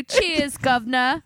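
cheery cockney send-up; mock British accent. Friend joking as a fake Brit in a cockney accent.

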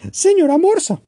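put-on high, small childlike bird voice; a tiny chick asking hopefully; little bird Choco. Choco calls the walrus. (pajarito Choco, voz aguda e infantil)

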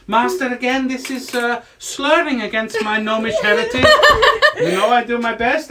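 high, protesting gnome servant; gnomey voice. Acted. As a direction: object to your master as a little gnome. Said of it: "your little gnomey voice".

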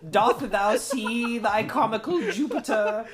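slow, high, loud theatrical ham; hammy stage actor. Pretend. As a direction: declaim like a bad Shakespearean. Ancient Greek comedy parody.

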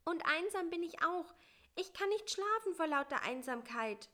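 small, high and plaintive; a little fly grumbling high up. Acted. Bissi to the spider at night, high and plaintive.

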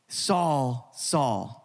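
SAUL, SAUL, each name drawn out and falling; emphatic address. Acted. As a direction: call SAUL twice with weight on each. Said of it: "It was emphatic".

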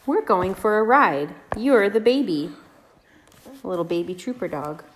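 gentle singsong read-aloud; reading to a toddler. Acted. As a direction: read it sweetly to little kids. Reads picture book to toddlers.

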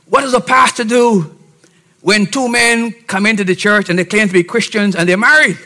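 usual speaking voice

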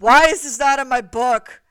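mock-furious demand; outraged scolding. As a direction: yell it in hammed-up indignation. Acting Destiny's outraged scolding.

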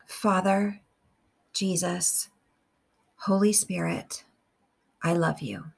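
slow, measured meditation with long pauses; guided prayer. Real, not acted. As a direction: pray phrase by phrase, unhurried, leaving room to repeat.

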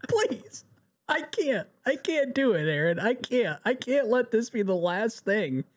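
high, whiny begging; pleading whine. Pretend. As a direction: plead like a heartbroken fan. Begging show creator for sequel.

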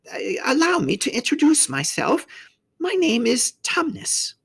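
high-pitched faun character voice; formal self-introduction in a high creature voice; courteous faun. A narrator's reading. Faun introducing himself to a girl, high and courteous.

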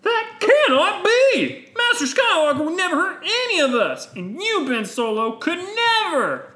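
high, loud protest; indignant Jedi. Acted. Jedi refuses to believe Ben's confession, high loud protest. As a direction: cry out in shrieking disbelief.

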